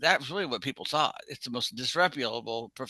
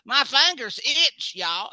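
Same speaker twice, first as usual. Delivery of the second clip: high, slow Southern-drawl line; Southern accent; 9 semitones higher, 5 dB louder, slower than usual. Retold or imitated. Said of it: "I couldn't handle it".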